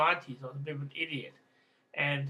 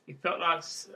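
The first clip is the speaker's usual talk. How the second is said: ordinary delivery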